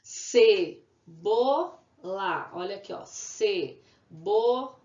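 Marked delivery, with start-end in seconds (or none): 0.0-1.8 "Cebola" very slow and loud, ce, bo, la with pauses; teacher sounding out "Cebola" in three beats; syllable by syllable. Shown as a demonstration. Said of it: "Quantas sílabas?". 3.1-4.8 "Cebola" split into ce, bo, la; slow "Cebola" with a gap after each syllable. Shown as a demonstration. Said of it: "Quantas sílabas?".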